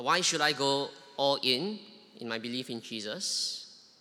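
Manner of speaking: ordinary talk, nothing marked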